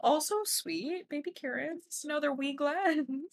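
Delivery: high, slow, soft coo. Real, not acted. Bartender cooing to co-host over tiny bottles.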